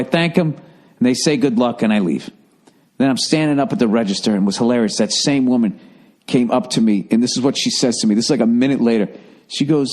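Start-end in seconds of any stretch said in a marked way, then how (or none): none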